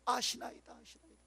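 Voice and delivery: soft, fading into a whisper; hushed confession. Acted. Peter answers Jesus in a hushed, fading whisper. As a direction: breathe the answer out, barely voiced.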